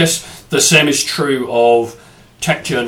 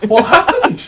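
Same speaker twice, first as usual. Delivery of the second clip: mock surprise; 7 dB louder than usual.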